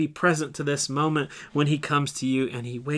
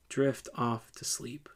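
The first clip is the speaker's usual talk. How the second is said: guided meditation. Acted. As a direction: lead the listener gently into the scene.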